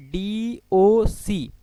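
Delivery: very slow, each letter stretched out; drawn-out angle name. Shown as a demonstration. Teacher reads the angle name to the class, very slowly drawn out. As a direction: draw out every letter so students follow. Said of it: "angle कैसे लेते हैं?".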